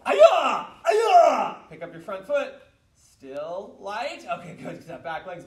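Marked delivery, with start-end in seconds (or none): 0.0-1.6 high, explosive two-syllable kiai; martial arts yell. Shown as a demonstration. Teacher leading students after four blocks, full-force kiai yell. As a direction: yell at full power, falling away.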